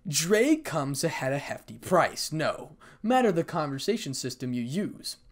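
usual speaking voice

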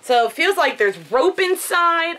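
ordinary talk, nothing marked

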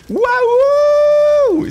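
high, loud cry of delight; a big thrilled gasp of wonder; amazed whoop. Real, not acted. Gamer thrilled to find the real grab pack, a loud high whoop.

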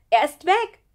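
loud, high outburst; distressed blurt, rising at the end. A narrator's reading. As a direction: burst out with it, voice shooting up. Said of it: "platzte es aus Lukas heraus".

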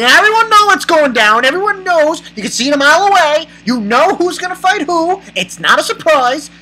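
high, loud, insistent rush; emphatic excitement. Real, not acted. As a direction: rattle it off high, insisting it's obvious. Excited about the obvious matchup.

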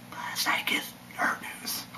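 unvoiced whispered aside. Real, not acted. Vlogger whispering a jab at the news network. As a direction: murmur it like a secret.

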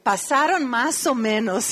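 ordinary talk

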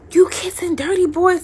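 high, loud imitated scolding mother; Caribbean mother. Mother confronting her daughter about kissing, imitated scolding without the accent. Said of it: "now a Caribbean mother".

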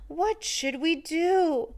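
put-on anxious children's cry. A narrator's reading. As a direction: cry out in alarm like small children. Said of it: "The children cried out".

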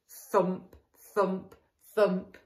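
plain reading voice

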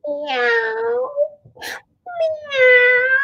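high cute meow; cat meow. Shown as a demonstration. Teacher showing kids the cat's sound, high mew. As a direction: mew like a little kitten. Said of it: "What sound does the cat make".

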